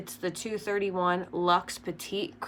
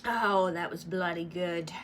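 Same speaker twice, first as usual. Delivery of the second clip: a hammed-up British-Irish accent, more English than Irish; a fake Irish lilt that slides into English; a bad Irish accent, really an English one; attempted Irish accent; 4 dB quieter than usual. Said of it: "Is that Irish?".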